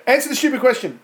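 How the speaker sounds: high, loud, impatient demand; exasperated order. Real, not acted. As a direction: push them loudly back on task. Quizmaster impatient with the digression.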